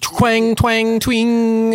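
high sung twang noises; mock guitar twang. Fake guitar sounds while jamming.

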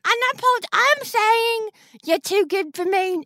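Janice. Acted. Disguised Chalice tries to release Anthony, high fake Janice voice. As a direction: let them down in a hammed-up high voice. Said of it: "that voice that I've dreamed of".

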